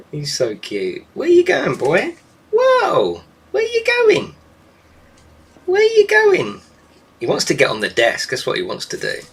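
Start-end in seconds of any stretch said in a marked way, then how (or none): 1.1-6.6 much higher, louder surprised calls; excited pet voice. Real, not acted. Owner calling after her flying bird, high and excited. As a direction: call after a bird taking flight.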